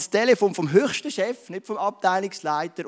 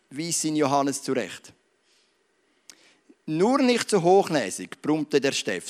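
put-on slow, measured reading from a children's history book; story read aloud; 4 dB louder, slower than usual. A narrator's reading. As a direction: read the tale aloud to the congregation, unhurried. Und dann sagt er Folgendes.

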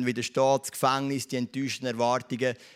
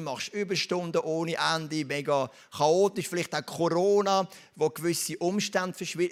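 ordinary talk, nothing marked

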